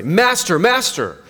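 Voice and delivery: frightened plea to the master in the storm; heightened emotion. Shown as a demonstration. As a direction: cry out the name twice in alarm. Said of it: "heightened emotion".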